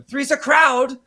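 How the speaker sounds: high, loud mock quote; movie line. Turning Tommy's own line against him, loud and mocking. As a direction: belt it out teasingly. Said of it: "use his own line against him".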